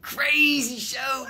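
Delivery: "Crazy show" stretched very slowly and shouted, leaning on "Crazy"; drawn-out shout. Acted. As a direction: drag out "Crazy show" like a carnival barker. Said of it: "You ready for a crazy show?".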